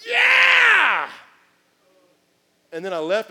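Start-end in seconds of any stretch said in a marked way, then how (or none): 0.1-1.1 very high, loud shouted yeah; crowd shouting. Retold or imitated. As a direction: whoop like a worked-up congregation. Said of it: "people were like".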